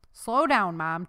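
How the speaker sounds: plain reading voice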